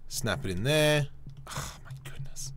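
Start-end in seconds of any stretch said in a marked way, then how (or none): 1.5-2.5 an astonished hush, barely voiced; thrilled whisper. Real, not acted. To viewers, thrilled with the new decal, in an astonished whisper. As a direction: whisper it like a delighted gasp.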